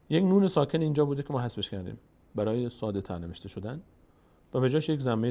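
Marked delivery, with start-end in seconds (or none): none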